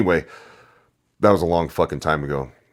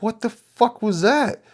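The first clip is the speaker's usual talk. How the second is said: much higher, slow, mock-baffled voice; stunned disbelief; slower than usual. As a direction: stretch it out like you can't believe it. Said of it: "and I was like".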